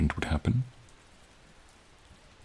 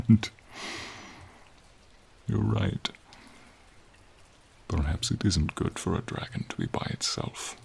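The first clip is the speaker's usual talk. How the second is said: usual speaking voice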